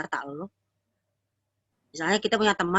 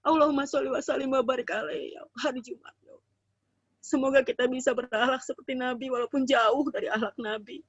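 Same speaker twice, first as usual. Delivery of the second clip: heartfelt prayer; 4 semitones higher than usual. Acted. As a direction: pray for the whole room, humbly. Leads a prayer.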